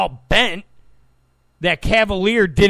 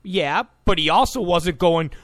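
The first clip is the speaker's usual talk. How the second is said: usual speaking voice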